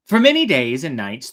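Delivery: ordinary delivery, nothing marked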